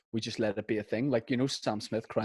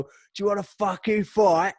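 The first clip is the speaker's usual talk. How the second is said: mock bar lad; 9 semitones higher, 4 dB louder than usual. Bar lad threatening fight.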